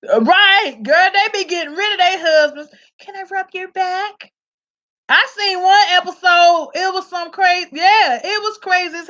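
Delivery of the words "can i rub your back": mock sweet offer.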